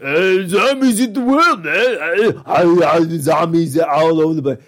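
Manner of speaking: loud, high-pitched mock movie dialogue; exaggerated shouted character voice; hammed-up action hero. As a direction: holler it like a dopey action star in a zombie film.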